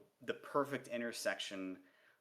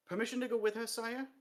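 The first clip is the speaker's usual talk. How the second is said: earnest higher dwarf voice; 11 semitones higher than usual. Acted. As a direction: ask bravely. Cheery eager request.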